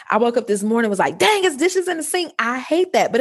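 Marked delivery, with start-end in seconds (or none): 1.2-3.1 high, whiny complaint about the sink; mock annoyance. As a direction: groan about the dishes playfully. Retells her morning complaint.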